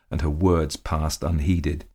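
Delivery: ordinary delivery, nothing marked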